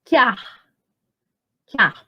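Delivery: "que ar" run together as one syllable, "quiar"; elisão. Shown as a demonstration. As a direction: melt the e of "que" into the a of "ar". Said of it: "a gente fala assim". ("que ar" numa sílaba só, com elisão)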